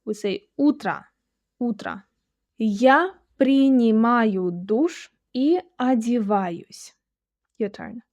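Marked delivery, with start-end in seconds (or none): 0.6-2.0 утро with the final o reduced to an a sound; утро, stressed first syllable, last vowel a soft a; unstressed o as a. Shown as a demonstration. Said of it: "So they become some a sound". 2.6-6.9 slow, drawn-out phrase for learners to repeat; measured language-teacher demonstration; slow model reading. Shown as a demonstration. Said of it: "shadow what I'm saying".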